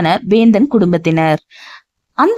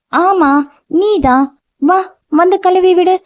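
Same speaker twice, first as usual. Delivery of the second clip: put-on childlike insisting; 10 semitones higher than usual. A narrator's reading.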